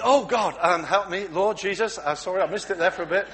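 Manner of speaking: loud, flustered praying; flustered wife blurting out prayers loudly. Acted. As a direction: scramble to pray after being caught.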